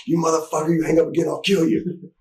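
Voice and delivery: high, mock furious threat down the phone; angry threat. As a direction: imitate a buddy yelling a threat. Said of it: "He goes".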